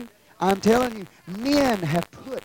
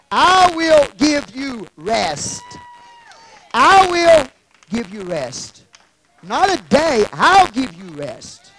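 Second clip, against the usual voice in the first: loud, slow, raised-pitch preaching; emphatic promise; 6 semitones higher, 9 dB louder, slower than usual. Acted. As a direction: proclaim it forcefully, stretching the words. Preacher booms out Jesus's promise.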